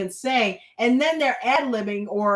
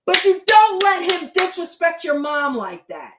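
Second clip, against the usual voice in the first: loud, raised-pitch telling-off; indignant scolding; 6 semitones higher, 6 dB louder than usual. Real, not acted. Scolding a son over his mom, indignant scolding. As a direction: lay into him, high and loud.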